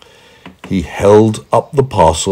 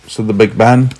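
usual speaking voice

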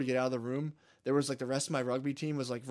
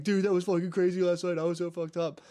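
higher, lively retold banter; excited teammates; 5 semitones higher than usual. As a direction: mimic friends buzzing about last night. Acts out laughing teammates next morning.

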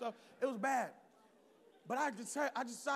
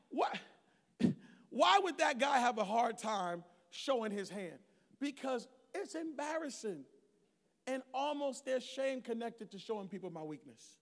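usual speaking voice

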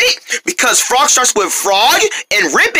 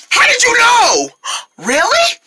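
astonished. Acted. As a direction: react amazed that he guessed it. Joke punchline guessed.

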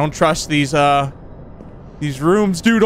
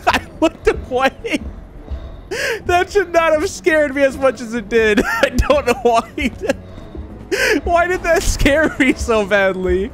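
shaky laughing; 7 semitones higher, slower than usual. Real, not acted. Laughing after jump scare.

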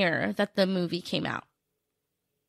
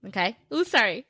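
usual speaking voice

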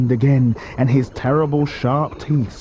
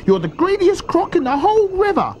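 high-pitched little-croc accusation; shrieky-high croc reproach; scolding small crocodile. A narrator's reading.

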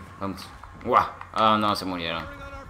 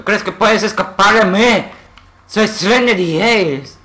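loud, high mock-heroic boast; Trinity Hayes; 6 semitones higher, 5 dB louder than usual. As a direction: declaim a threat to fleeing enemies, pitched up. Boasts as game character to enemies.

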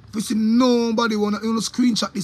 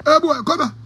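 imitating a cop calling out, higher and louder; police shout; 9 semitones higher, 6 dB louder than usual. Retelling a cop calling a youth, mock shout. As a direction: bark it like a cop summoning the boy.

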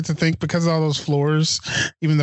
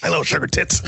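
Mel Gibson impression. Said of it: "Yeah, like that".

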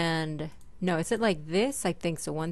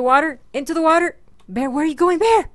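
calling out; 6 semitones higher, 7 dB louder, faster than usual. Real, not acted. Calling dog running off.

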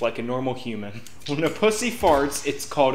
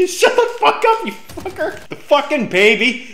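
laughing yell, much louder and higher; too loud indoors; 11 semitones higher, 5 dB louder than usual. Pretend. Yelling that the baby sleeps.

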